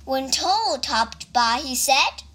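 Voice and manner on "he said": ordinary delivery, nothing marked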